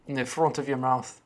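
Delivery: put-on soft, wobbly Scottish accent sliding into Irish; half-hearted Scottish-Irish accent; attempted Scottish accent. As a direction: try Scottish, land nearer Irish. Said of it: "That felt more Irish".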